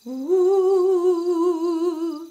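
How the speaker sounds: put-on spooky rising ooh for kids; ghost noise.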